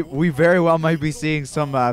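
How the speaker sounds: ordinary talk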